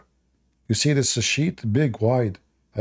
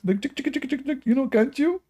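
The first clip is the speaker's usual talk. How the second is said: high pleading voice; 12 semitones higher than usual. Real, not acted. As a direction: plead sweetly, way up.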